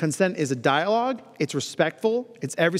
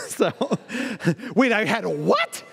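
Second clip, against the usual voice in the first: laughing, higher mock surprise; laughing disbelief; 4 semitones higher than usual. Real, not acted. Speaker laughing at his own joke, higher and surprised. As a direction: laugh through the double take.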